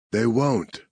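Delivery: a touch of firm certainty; sure of himself. A narrator's reading. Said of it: "Marcus sounded so sure of himself".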